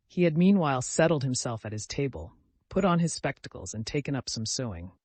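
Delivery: plain reading voice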